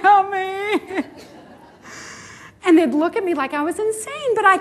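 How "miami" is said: a retold teary answer, half laughing, half crying; tearful. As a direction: say Miami like you're about to cry. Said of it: "I found myself just welling up and crying".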